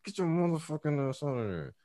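hissed through clenched teeth; through gritted teeth. Shown as a demonstration. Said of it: "When you talk through your teeth".

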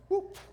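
high, loud whoop; sudden high-pitched mouthed swoosh; whoop sound effect. Shown as a demonstration. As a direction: imitate the axe head flying off. Imitates axe head flying off.

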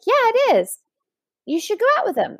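bright, high friend voice; friend's voice. Retold or imitated. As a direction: play the eager friend pushing a date. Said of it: "And she's like".

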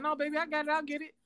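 high, soft and sweet, eager to help; sweet eager offer. Retold or imitated. Boyfriend offering his girlfriend the sauce at dinner, sweetly high and soft. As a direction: play the doting boyfriend jumping up to fetch it. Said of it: "and I said".

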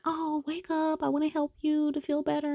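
mock sweet bedside voice; gentle wake-up. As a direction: coax her awake softly. Gently waking her friend.

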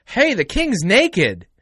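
put-on high, slow drawn-out kid's call; hammed-up child's blurting cry; outspoken kid calling out. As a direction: imitate a child shouting it to the crowd. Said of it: "the gumption to sit there and go".

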